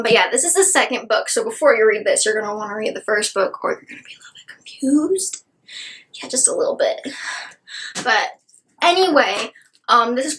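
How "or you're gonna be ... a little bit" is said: playful whispered aside, high and breathy. Real, not acted. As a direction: lean in and whisper the warning. Conspiratorial aside to viewers.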